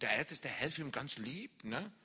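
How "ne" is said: ordinary talk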